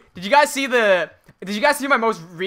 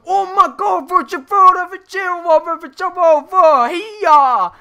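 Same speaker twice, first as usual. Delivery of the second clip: high, very loud Australian yelling in alarm; panicked Australian; 12 semitones higher, 10 dB louder than usual. Retold or imitated. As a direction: impersonate a shrieking Australian bloke at full volume. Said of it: "That's the most Australian it can possibly get".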